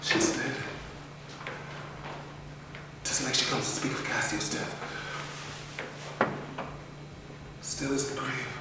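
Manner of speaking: very soft, low murmured aside; hushed whisper. Acted. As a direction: whisper so no one outside hears. Othello whispers to himself.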